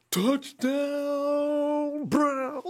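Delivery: high, loud celebrating shout; fan's joyful touchdown cry; touchdown call. Real, not acted. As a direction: yell it out as the team scores. Fan calls the touchdown.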